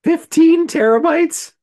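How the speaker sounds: high, loud, stretched-out question; can't believe the number; shocked disbelief. Real, not acted. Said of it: "Holy shit".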